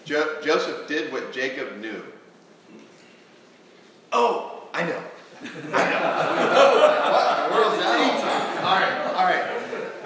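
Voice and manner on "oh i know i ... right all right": laughing. Real, not acted. Congregation laughter, amused.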